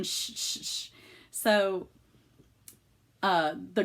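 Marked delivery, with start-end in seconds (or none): 0.0-0.9 soft hissed "shh, shh, shh"; shushing. Retold or imitated. Kids hushing before grandparents' surprise party, softly shushing. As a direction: imitate kids hushing each other softly. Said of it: "my kids in the background saying".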